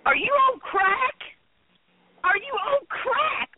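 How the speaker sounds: incredulous. Real, not acted. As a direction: demand it in disbelief, voice jumping up. Co-host mocks Bob's letter.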